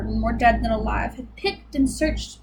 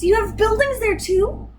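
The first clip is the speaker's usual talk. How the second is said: higher, wide-eyed amazement; amazed; 9 semitones higher than usual. A narrator's reading. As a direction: ask it in wonder. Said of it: "Horton gasped".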